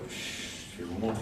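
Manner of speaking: breathy whispered shush for silence. Real, not acted. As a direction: hush them with a soft whisper. Shushing the audience before a trick.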